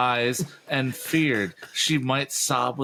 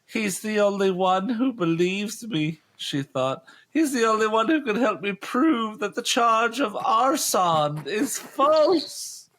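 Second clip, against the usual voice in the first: heroine voice; 12 semitones higher than usual. Acted.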